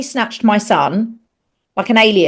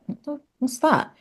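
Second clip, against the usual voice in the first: soft, high, baffled; half to yourself, confused; retold puzzlement; 8 semitones higher, 8 dB quieter than usual. Mother finding an unknown term online, quietly baffled. Puzzled reaction to search results.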